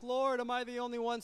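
high, whiny plea; mock crying to the Lord. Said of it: "crying, like".

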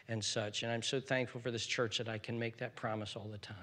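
normal talking voice

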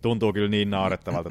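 normal talking voice

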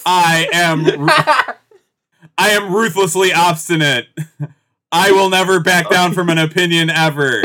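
slow, proud parody declarations breaking into laughter; lightly hammed-up self-declarations, laughing; mock stiff declaration. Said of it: "it's like".

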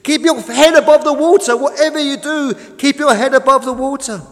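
high, loud shouted urging; urgent calling out. A narrator's reading. Tug urges the sinking boat, shouting high. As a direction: shout encouragement across the water.

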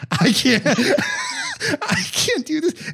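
laughing. Real, not acted. Panicking while laughing at himself.

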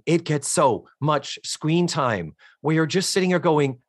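ordinary talk

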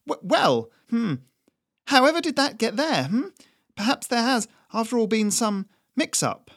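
stammering, high, falsely sweet; caught out, flustered. Acted. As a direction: bluff your way out, caught red-handed. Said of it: "all the bluster was gone from her voice".

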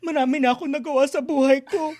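high, teary teleserye monologue; fake crying. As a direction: act out a soap opera breakdown. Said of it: "and just be like".

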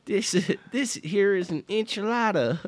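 very high, hammed-up Southern accent. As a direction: show it off in a folksy Southern accent. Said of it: "I don't know why we do Southern accents".

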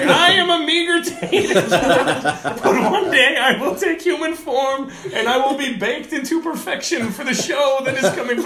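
high, loud, mock-grand declaration through laughter; tater tot proclamation. Comedian's post as a meager tater tot, high laughing proclamation. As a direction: proclaim it like a tiny would-be overlord, laughing.

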